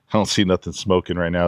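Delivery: ordinary delivery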